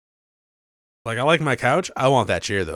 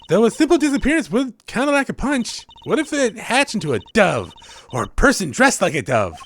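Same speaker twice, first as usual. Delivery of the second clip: put-on high, bright young magician girl; voicing the girl sidekick, perky and high; cheery girl voice; 10 semitones higher, 3 dB louder than usual. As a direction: play the bubbly teen assistant, pitched up. Voices Trucy in game dialogue.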